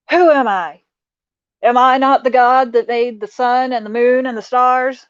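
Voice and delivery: loud, mock-angry divine voice; mock booming God. Retelling the movie, God thundering at Moses. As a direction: boom it out like an offended God. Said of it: "He does get mad".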